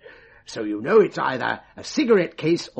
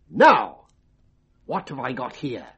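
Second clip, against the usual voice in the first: "Now," loud, then a pause before "what have I got here?"; pause after "Now"; 4 dB louder than usual. Shown as a demonstration. Mind reader shows his stooge the code, "Now," then a deliberate pause. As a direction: hold a beat after "Now," then ask. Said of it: "If I pause for one second".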